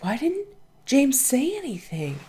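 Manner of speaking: mock disbelief, light and airy; baffled self-quote. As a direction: pitch jumps way up on a puzzled question. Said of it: "I was like".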